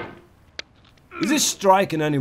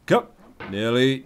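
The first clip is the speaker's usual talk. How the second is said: ordinary delivery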